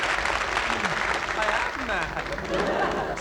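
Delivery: drawn-out confession. Acted. Drawn-out mock admission.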